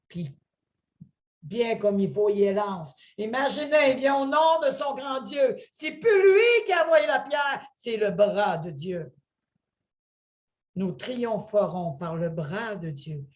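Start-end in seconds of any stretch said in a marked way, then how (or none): none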